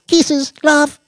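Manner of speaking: put-on very high, loud, girlish goodbye; giggly farewell. A narrator's reading. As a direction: squeal a loving goodbye. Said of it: "with a giggle".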